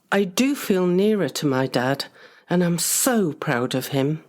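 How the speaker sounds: usual speaking voice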